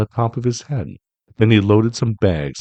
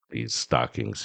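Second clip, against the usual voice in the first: grinning Grinch; 10 semitones lower, 7 dB quieter, slower than usual. A narrator's reading. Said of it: "he grinned".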